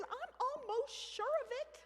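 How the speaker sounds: mock-earnest sure of it; high, slow, earnest insistence. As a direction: say it high and slow like a stubborn child. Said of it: "I said".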